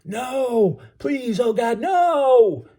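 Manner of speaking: very high, desperate pleading scream; drawn-out, high terrified wail; piercing scream. A narrator's reading. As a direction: scream for your life across water. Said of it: "ear-shattering scream carried across the water".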